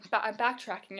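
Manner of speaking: ordinary talk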